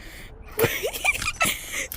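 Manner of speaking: a sudden squeal of laughter; high squealing laugh. Real, not acted. Friend trolled on voice chat, squealing laughter. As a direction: shriek with delight at the prank.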